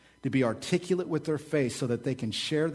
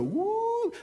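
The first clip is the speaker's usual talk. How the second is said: excited whoop. Acted. Preacher's excited cheer.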